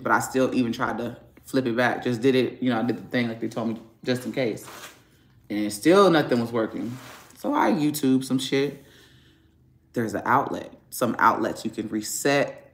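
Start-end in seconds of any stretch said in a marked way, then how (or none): none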